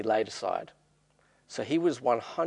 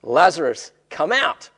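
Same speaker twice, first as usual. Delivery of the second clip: raised, commanding shout, higher on COME OUT; loud calling out; 5 semitones higher, 9 dB louder than usual. A narrator's reading. Jesus summoning dead Lazarus from the tomb, loud calling out. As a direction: call out loudly to summon someone. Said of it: "called out in a loud voice".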